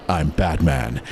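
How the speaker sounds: low, gravel-free heroic growl; deep Batman voice. Retold or imitated. Actor imagining Batman's line, deep heroic voice. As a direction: drop it low like a brooding hero. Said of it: "this really deep, heroic voice".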